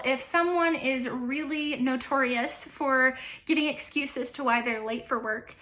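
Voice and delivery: usual speaking voice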